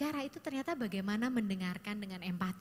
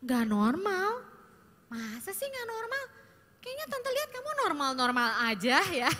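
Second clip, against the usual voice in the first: loud brushing-off of the feeling, mock surprise; aunt dismissing a teen's worry with a loud, disbelieving tone; dismissive aunt reply; 5 semitones higher, 4 dB louder than usual. Shown as a demonstration. Aunt brushes off teen's feeling, loud mock disbelief. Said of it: "kita langsung latihannya begini".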